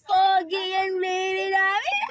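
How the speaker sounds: high singsong cooing. Real, not acted. Singsong praise to crying baby.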